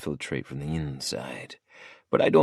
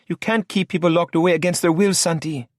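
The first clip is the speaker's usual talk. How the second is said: high female voice, indignant; Lola protesting; 12 semitones higher, 4 dB louder than usual. A narrator's reading. Lola objects to Santi locking people away, high and indignant. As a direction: object sharply in a higher voice.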